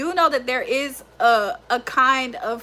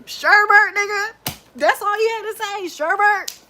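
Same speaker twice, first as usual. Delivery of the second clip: higher, incredulous mock teasing; mocking friends; 8 semitones higher than usual. Friends teasing her over sherbet, higher and mocking. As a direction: repeat the word back in disbelief. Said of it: "That's what they gonna say to me".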